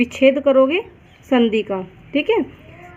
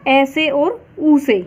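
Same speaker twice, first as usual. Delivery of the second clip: ordinary delivery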